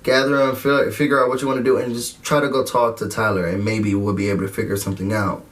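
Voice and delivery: calm her down gently; a hint of a soothing friend; reassuring. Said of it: "she was like".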